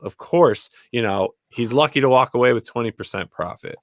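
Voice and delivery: ordinary talk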